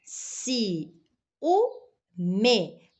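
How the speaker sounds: teacher repeating the syllable split for the class. Shown as a demonstration. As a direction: count out Si, u, me one at a time. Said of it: "Vamos lá, ó".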